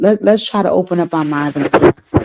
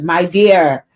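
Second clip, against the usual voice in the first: normal talking voice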